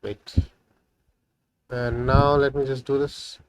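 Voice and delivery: ordinary talk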